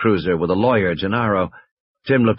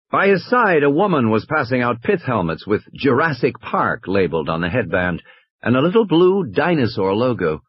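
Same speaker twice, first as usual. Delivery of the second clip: usual speaking voice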